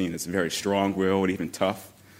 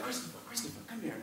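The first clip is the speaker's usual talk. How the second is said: much softer, higher grandma calling; hushed grandma call; 11 semitones higher, 17 dB quieter, much faster than usual. Retold or imitated. Grandmother quietly calling her grandson over to meet a student, hushed. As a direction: beckon him over in a hush.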